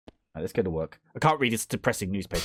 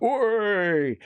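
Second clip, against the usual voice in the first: mock cheer; 8 semitones higher than usual. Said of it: "you'd be like".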